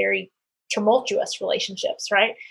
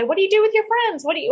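much higher, bright curious question; imitating her own eager question; asking friends; 10 semitones higher, faster than usual. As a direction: pitch up high to ask the question. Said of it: "so many times I say".

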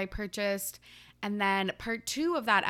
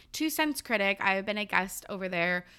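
usual speaking voice

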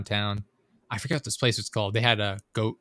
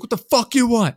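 higher, louder heckler's yell; imitated street shouting; 12 semitones higher, 6 dB louder than usual. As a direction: yell it like a guy across the street. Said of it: "just shouting".

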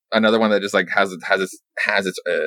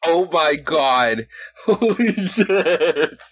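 slow, drawn out and laughing, pitched up; laughing disbelief; 8 semitones higher, much slower than usual. Real, not acted. Listener laughing in disbelief at the nun twist. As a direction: stretch it out, cracking up. Reacting to outrageous nun story.

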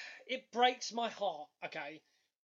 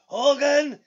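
higher, loud called-out name; Andre calling out Hogan; 5 semitones higher, 12 dB louder than usual. Retold or imitated. Cohost imitating Andre calling out Hogan, loud mock shout. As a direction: shout the rival's name into the camera. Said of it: "looks at the camera and goes".

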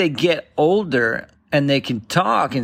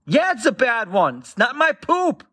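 higher mock child voice, sassy; cheeky toddler; 9 semitones higher than usual. Toddler mocking dad over the diaper, high and cheeky. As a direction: tease dad like a smug kid. Toddler keeps talking back.

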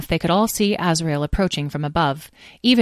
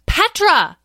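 much higher, sharp name call; exasperated call; 7 dB louder than usual. Acted. Edwin calling an ignoring sister, exasperated shout. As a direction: demand attention at last. Last call to inattentive Petra.

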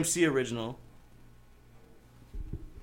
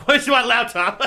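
louder, slow through laughter; laughing; 10 semitones higher, 6 dB louder, slower than usual. Real, not acted. Mocking the drunk friend's line, laughing. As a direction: laugh while asking.

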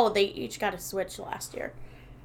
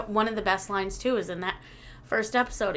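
ordinary talk, nothing marked

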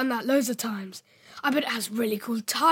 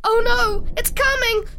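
scared; 8 semitones higher, 4 dB louder than usual. Acted. Child fearing the figure.

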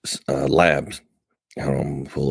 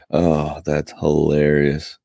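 ordinary talk, nothing marked

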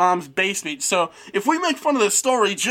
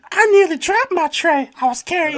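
put-on higher girlish read; voiced up and off; wrong take; 7 semitones higher than usual. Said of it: "you fucked that up already".

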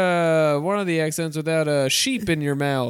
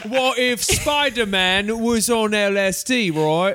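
slow, higher mock Cockney; South End Cockney; 4 semitones higher, slower than usual. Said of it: "I'm a South End Cockney".

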